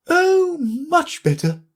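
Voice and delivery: high, slow Queen exclamation; Queen answering Alice; Queen crying out. A narrator's reading. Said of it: "cried the Queen".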